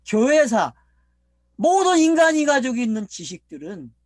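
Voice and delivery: loud, emphatic preaching in driving lists; forceful preaching. Real, not acted. Preacher denouncing the scholars' world of learning, forceful preaching. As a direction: hammer each item home to the congregation.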